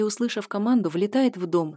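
ordinary delivery

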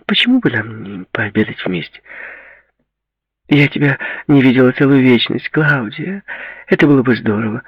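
put-on high, coaxing girlfriend voice; eager girlfriend. Girl proposing lunch to her old friend, eager. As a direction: coax eagerly, keeping the pitch up.

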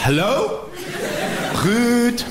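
high, far-off calling voice; hammed-up high questioning call; mock disbelief. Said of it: "Dus ik zeg".

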